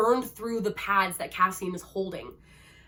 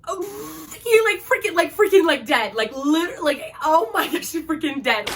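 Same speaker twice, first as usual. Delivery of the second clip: much higher and louder, near tears; distraught outburst; 7 semitones higher, 7 dB louder than usual. Real, not acted. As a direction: cry it out in shock. Reliving Rhys's death, crying out.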